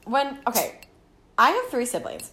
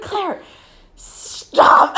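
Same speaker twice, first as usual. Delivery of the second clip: high, loud mock-exasperated order; exasperated scolding; 10 semitones higher, 8 dB louder than usual. Sister silencing crying sister, mock exasperated. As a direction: cut off the sister's wailing.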